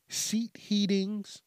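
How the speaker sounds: "seat heatings" said slow and high, as if puzzling; odd emphasis. Real, not acted. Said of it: "why the hell I said it like that".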